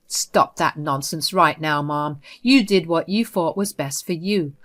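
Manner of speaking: plain reading voice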